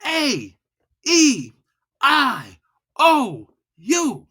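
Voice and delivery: high, separated vowels; slow, loud, high vowel drill. Shown as a demonstration. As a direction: hold each vowel, one at a time. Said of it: "Loud and slow".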